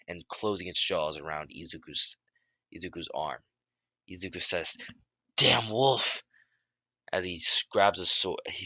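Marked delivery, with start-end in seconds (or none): 5.4-6.2 loud, high-pitched curse; angry outburst. A narrator's reading. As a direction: cry out at the beast that bit you. Character curses wolf biting arm.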